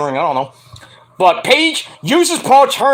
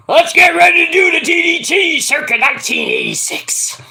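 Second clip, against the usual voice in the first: mock announcer; 8 semitones higher than usual. Fan mimicking Jake with a mock announcer shout. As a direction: boom it out like a ring announcer. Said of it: "And he goes".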